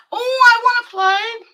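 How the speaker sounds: high, loud burst of eagerness; excited eagerness. Real, not acted. Streamer hearing about a geography game, excited eagerness.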